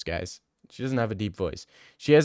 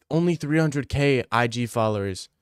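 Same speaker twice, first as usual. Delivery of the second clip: ordinary delivery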